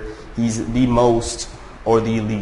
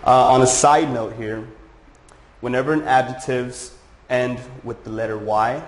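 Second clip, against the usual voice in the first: ordinary talk, nothing marked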